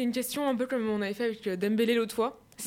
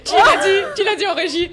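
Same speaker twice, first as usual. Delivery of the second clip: higher, louder and laughing as he asks who said it; laughing outburst; 7 semitones higher, 11 dB louder than usual. Real, not acted. Host catching the control room feeding answers, loud laughing demand. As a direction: burst out laughing as you demand who gave it away.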